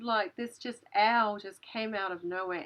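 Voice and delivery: ordinary delivery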